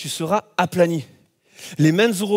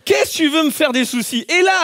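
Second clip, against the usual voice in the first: normal talking voice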